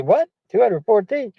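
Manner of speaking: high disbelieving double take; incredulous question. Retold or imitated. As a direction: act baffled by the number. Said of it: "I was gonna say".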